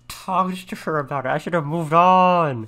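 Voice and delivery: loud, high, drawn-out cry of regret; wailing dismay. Real, not acted. Player who made a friend cry in the game, dismayed wail.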